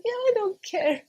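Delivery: high, laughing mock defiance; defiant don't care. Mother in labour defying her midwife, high mock defiance. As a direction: toss it off like a stubborn patient.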